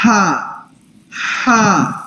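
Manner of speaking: ہا said with an audible breath hiss from the throat; breathy throat ḥa. Shown as a demonstration. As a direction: let the breath rasp through ہا from mid-throat. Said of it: "اس میں یہ آواز آنی ہے سانس کی". (ہا سانس کی آواز کے ساتھ حلق سے)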